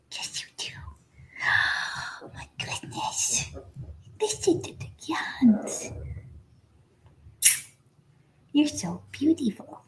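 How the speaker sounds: whispered coaxing to a newborn pup; soft whisper to puppy. Real, not acted. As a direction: coax the puppy softly. Hushed awe at puppy.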